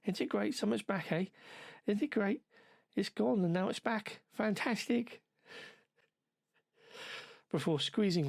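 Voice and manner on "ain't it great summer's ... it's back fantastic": high, excitable mock Scouse passer-by; attempted Scouse accent. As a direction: imitate a delighted Scouser, pitched well up. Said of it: "I can't do a scouse accent".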